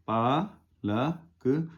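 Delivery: पालक stretched very slow, each syllable pa-la-k held; slow demonstration of पालक, syllable by syllable; drawn out slowly. Shown as a demonstration. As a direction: draw out पालक slowly for the learner. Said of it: "पा पर आ की मात्रा".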